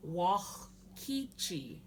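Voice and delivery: slow "Wakichi" with a raspy throat sound after "Wa"; guttural X. Shown as a demonstration. Teacher modelling for everybody to try, "Wakichi" with guttural X after "Wa". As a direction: say "Wakichi" slowly, scraping the throat before "ki". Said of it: "and there's the X".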